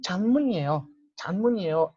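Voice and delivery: Chanmunieo with the n sliding into ie; linked pronunciation. Shown as a demonstration. Teacher models chanmunieo linked, fluid. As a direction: chanmunieo said as one flowing word. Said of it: "así suena más fluido".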